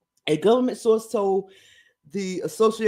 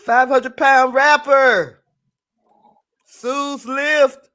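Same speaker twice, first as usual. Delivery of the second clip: amazed; 4 semitones higher, 7 dB louder, much slower than usual. Real, not acted. Reading a headline aloud.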